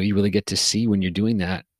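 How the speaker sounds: ordinary talk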